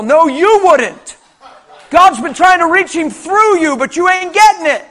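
loud, high fervent preaching; full-force preaching. Acted. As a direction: preach it out with all your fire.

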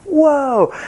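high, astonished gasp of a word; amazed whoa. Retold or imitated. Retelling the woman who saw her neck healed, amazed. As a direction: react like a miracle just happened. Lady freaking out at healing.

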